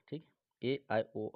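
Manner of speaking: ordinary talk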